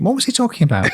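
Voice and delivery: high, puzzled inner voice; a high, bewildered question to yourself; baffled thought. Retold or imitated. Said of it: "you finish thinking".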